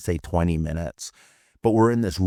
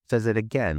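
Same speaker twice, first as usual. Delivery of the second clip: plain reading voice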